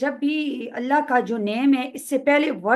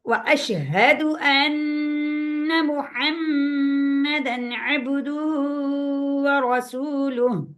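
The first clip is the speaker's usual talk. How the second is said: very slow, melodic Arabic chant; slow recitation; 3 semitones higher, much slower than usual. Shown as a demonstration. Teacher reciting the testimony to students, slow chant. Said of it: "हा का ख्याल नहीं कर रहे होते".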